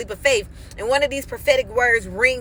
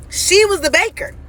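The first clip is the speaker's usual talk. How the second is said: excited realisation; 8 semitones higher, 3 dB louder than usual. Real, not acted.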